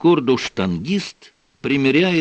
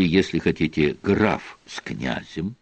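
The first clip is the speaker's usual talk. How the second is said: usual speaking voice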